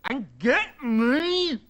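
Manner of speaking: very slow, high, loud order; petulant, climactic insistence; angry demand. A narrator's reading. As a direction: drag the demand out to the limit. Angry son demands bananas.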